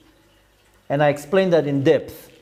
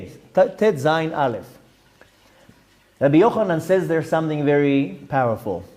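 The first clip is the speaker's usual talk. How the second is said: usual speaking voice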